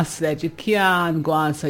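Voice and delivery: normal talking voice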